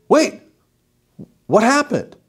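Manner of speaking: high, startled wait what; surprised. Retold or imitated. Audiologist hearing the patient's new test scores, surprised. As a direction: caught off guard by the bad result. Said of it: "And I said".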